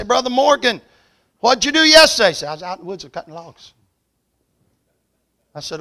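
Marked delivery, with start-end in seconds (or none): 0.0-2.3 loud, higher hail across a distance; calling out. Retold or imitated. Calling out to Brother Morgan. 2.5-3.7 quick, softer Kentucky mumble; soft rapid Kentucky speech. Retold or imitated. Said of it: "he spoke softly and rapidly with a Kentucky accent".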